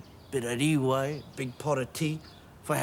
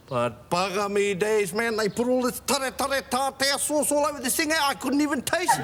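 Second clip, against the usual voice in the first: high-pitched, worked-up storytelling; hammed-up indignant grumbling; exasperated comic rant; 9 semitones higher than usual. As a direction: climb higher as the complaint builds. Comic rant about the fancy restaurant.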